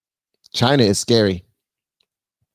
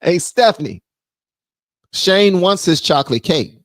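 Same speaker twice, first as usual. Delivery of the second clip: calling out; 8 semitones higher than usual. Real, not acted. Calling wife Stephanie from the room, raised call.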